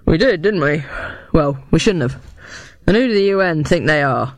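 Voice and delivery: gruff, grudging comeback; grudging bluster. A narrator's reading. Cafe owner conceding, then blaming the U N, gruff.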